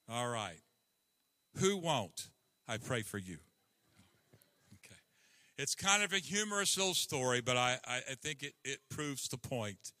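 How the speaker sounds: ordinary delivery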